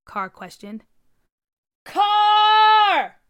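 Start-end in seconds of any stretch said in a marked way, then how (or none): 1.8-3.2 high, loud panicked scream. A narrator's reading. Dad screaming for his son from the basement. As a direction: yell it from the basement. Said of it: "his dad screamed from the basement".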